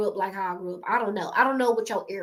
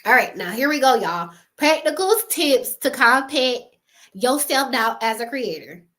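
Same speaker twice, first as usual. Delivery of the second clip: usual speaking voice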